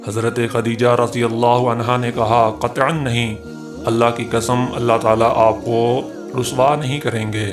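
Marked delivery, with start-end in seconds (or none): none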